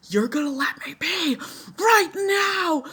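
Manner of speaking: high, loud furious yell at the nurses; angry demand. Retold or imitated. To nurses refusing a bathroom trip, furious shout. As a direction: shout it in a rage, pitch jumping up. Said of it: "I was getting so mad".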